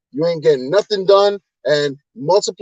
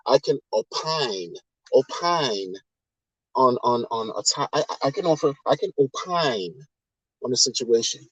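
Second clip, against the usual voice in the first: mocking pompous phrasing; 5 semitones lower, 7 dB quieter than usual. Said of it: "the way that people say".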